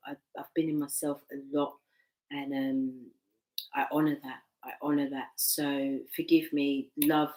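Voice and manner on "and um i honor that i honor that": ordinary delivery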